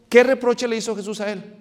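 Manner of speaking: ordinary talk, nothing marked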